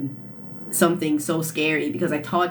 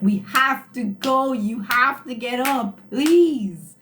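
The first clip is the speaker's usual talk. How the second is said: dad begging harder; 5 semitones higher, 3 dB louder, slower than usual. Retold or imitated. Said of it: "he was like".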